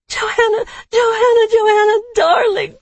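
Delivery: high crying calls. Real, not acted. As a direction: call the name through tears. Delirious crying out a name.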